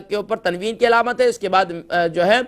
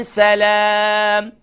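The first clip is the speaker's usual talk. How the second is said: slow drawn chant; recitation; much slower than usual. Shown as a demonstration. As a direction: stretch سَلَامٍ slowly. (تلاوت)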